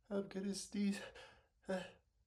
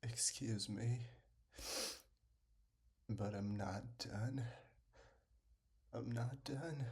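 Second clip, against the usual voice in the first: whisper; 10 semitones lower than usual. Real, not acted. More sneezes coming.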